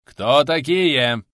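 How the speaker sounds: loud, slow authoritative challenge; commanding. A narrator's reading. Patrol challenging strangers in the fog, commanding voice. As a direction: demand it loudly, drawing out the words. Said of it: "Властный голос".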